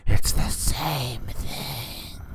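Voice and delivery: high, breathy, very drawn-out retort; drug queen. Acted. Drug queen insisting a knife counts as needle, strained high caricature. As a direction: let the words stretch into a whispery squeal.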